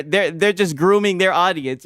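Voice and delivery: ordinary talk, nothing marked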